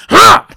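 loud, high burst of a laugh; a big high cackle of glee; triumphant laugh. Acted. Ending his own story with a loud triumphant laugh.

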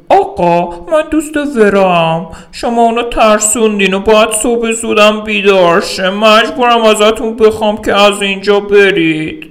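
high, firm voice of the friend telling him to leave; woman's voice. Acted. The friend asks the drunk to leave, in a high, firm woman's voice.

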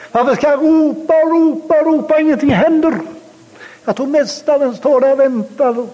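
high-pitched impassioned sermon delivery; fervent preaching. Acted. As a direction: preach it high and urgent, urging them to hold on. Preacher rises into full force.

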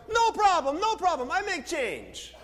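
high, eager local vendor; local man. Retold or imitated. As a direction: burst out high and loud, then stretch the last words. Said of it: "the man turns around and he goes".

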